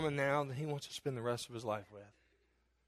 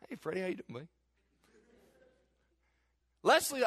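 usual speaking voice

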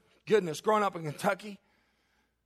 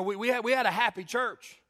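ordinary delivery

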